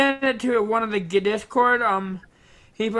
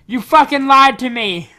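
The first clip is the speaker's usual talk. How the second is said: loud, higher-pitched protest; angry accusation; 5 semitones higher, 7 dB louder than usual. Real, not acted. Host accusing a guest of lying, loud and angry. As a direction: confront them, raised voice.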